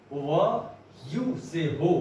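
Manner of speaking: ordinary delivery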